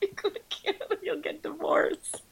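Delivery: laughing fake Canadian accent; a weak try at Canadian; bad Canadian accent. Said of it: "That's the worst Canadian accent ever".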